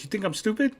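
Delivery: mock offended, voice lifting on each question; getting mad. Dad insulted by his son's doubt, getting mad. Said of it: "'Cause he gets mad".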